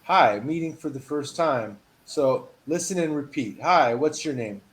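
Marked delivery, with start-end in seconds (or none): none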